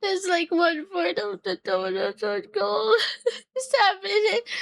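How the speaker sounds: laughing through happy tears; tearful laugh, overwhelmed; crying. Real, not acted. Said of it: "shouldn't cry".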